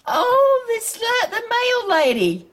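sudden joy at figuring out the sender; delighted surprise. Real, not acted. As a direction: gasp out the discovery, thrilled. Realizing who sent the note.

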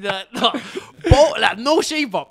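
high, loud, slow laughing protest; real laughter through no; laughing refusal. Real, not acted. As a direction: laugh while refusing outright.

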